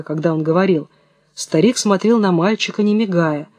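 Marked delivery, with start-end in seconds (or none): none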